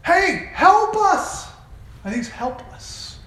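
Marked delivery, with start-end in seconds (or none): 0.0-1.5 a drawn-out, higher cry for help; pleading call. Retold or imitated. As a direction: call out to the king for help, slowly. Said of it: "your people are calling out to you".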